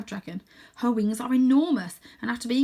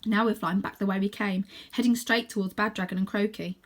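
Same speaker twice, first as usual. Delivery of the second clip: plain reading voice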